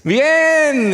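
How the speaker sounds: high, drawn-out "fine", hammed up; toothy, oblivious grin. Churchgoer answers with cilantro in teeth, grinning obliviously. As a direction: stretch it through a showy smile. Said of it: "te sonríes y hasta muestras los dientes".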